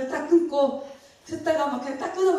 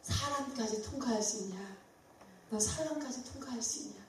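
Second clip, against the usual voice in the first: quiet divine question; 11 dB quieter than usual. Retold or imitated. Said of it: "그랬더니".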